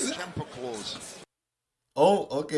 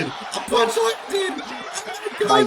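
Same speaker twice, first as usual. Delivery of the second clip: high, slow shocked yell; excited shout; slower than usual. Real, not acted. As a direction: stretch it out in high delight. First punch finally lands.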